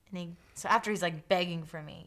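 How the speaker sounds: ordinary talk, nothing marked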